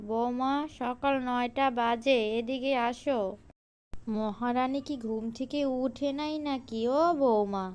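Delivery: ordinary talk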